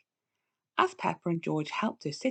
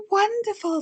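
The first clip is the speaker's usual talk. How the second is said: high, loud wonderful after a gasp; delighted; 13 semitones higher, 6 dB louder than usual. A narrator's reading. Grandparents hearing the baby news, delighted gasp. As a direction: burst out with joy.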